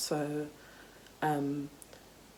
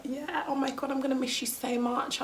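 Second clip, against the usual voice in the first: high-pitched insincere farewell; sugary fake affection, pitched high; fake gushing; 8 semitones higher than usual. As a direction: imitate a gushy school-leaver's goodbye. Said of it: "you're just never talking to her again".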